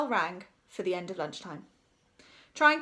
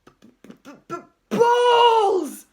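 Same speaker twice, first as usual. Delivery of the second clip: stuttered start exploding into a long shout; stammering outburst; 13 semitones higher, 13 dB louder, much slower than usual. A narrator's reading. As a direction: choke on the first sound, then roar. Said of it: "could barely bring himself to say".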